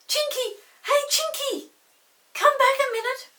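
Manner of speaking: very high, loud calls; calling out. Acted. Peter calls from the door after Chinky. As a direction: call after him across the garden. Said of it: "He ran to the door and called".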